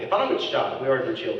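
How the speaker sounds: usual speaking voice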